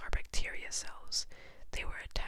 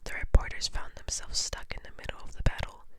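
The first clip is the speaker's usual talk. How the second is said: plain reading voice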